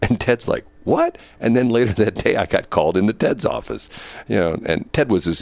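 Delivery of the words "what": much higher, startled what; the boss can't believe it; yelped, disbelieving what; shocked. Retold or imitated.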